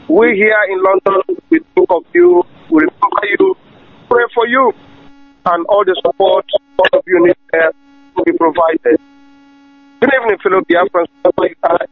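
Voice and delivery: normal talking voice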